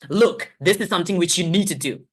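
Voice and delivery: loud, firm coach voice. Shown as a demonstration. Teacher switching tone to coach the learner, firm coach. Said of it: "I can even go the coach".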